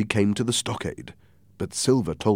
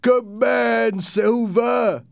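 high, loud sailor's cheer; cheering shout; 4 dB louder, much slower than usual. Acted. One pirate hailing Silver, shouting. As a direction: shout approval in a pitched-up voice. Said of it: "one shouted".

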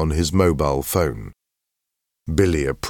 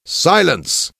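high, raised command for silence; commanding headmaster; 12 semitones higher, 4 dB louder than usual. A narrator's reading. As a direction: call a noisy hall sharply to order. Headmaster hushes whispering students.

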